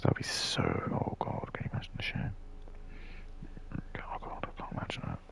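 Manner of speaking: hushed, barely voiced mock dread; mock horrified whisper. Someone dreading the neighbours finding out, mock horrified whisper. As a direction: whisper it as if scandalised, hamming up the shame. Acting out neighbour-fearing person's shame.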